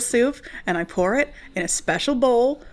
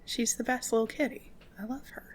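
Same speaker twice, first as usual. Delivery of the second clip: tender cooing; 5 semitones higher, 7 dB quieter than usual. Real, not acted. Doting on her cat.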